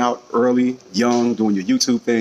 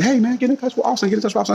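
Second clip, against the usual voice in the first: high mock voice; 13 semitones higher than usual. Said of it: "people were saying".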